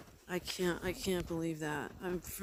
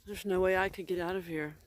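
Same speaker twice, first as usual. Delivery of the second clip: usual speaking voice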